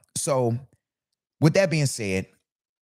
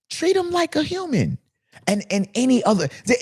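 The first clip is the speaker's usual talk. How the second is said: high, urgent plea; pleading from the heart; emphatic rant; 11 semitones higher than usual. Real, not acted. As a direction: hit it hard and high. Rant peak.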